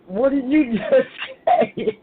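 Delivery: high, loud, slowed double take with a laugh; laughing what did you just say; amused disbelief. Retold or imitated. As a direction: act stunned and amused by a child's words. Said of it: "You be like".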